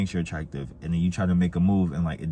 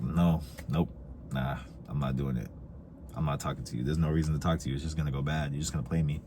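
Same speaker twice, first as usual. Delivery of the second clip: imitating nervous girl; 5 semitones lower, 8 dB quieter, faster than usual. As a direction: lower and softer mock refusal. Voicing a nervous girl who refuses.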